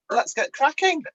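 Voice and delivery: normal talking voice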